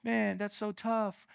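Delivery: coddle him, letting the voice sink away; mock sympathy. Preacher imagining Jesus indulging the man's complaint, mock sympathy. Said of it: "Jesus doesn't even say".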